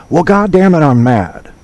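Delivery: imitating a comedian fed up on stage; mock mad. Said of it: "he would say".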